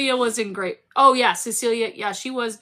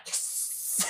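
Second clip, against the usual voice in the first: voiceless, hissed little victory yes; whispered fan triumph; hushed cheer; 15 dB quieter than usual. Acted. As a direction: celebrate under your breath. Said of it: "I'm like".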